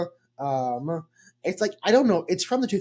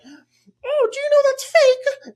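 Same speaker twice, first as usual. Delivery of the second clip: put-on much higher, slow know-it-all girl; high girlish voice, smug; Hermione voice; 8 dB louder, much slower than usual. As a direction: Hermione smugly pointing out it's fake. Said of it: "Hermione's like".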